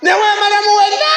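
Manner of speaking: high loud shout. Acted. Preacher shouts out the line to the church, loud. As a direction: burst out shouting. Acted outburst.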